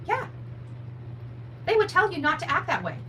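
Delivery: usual speaking voice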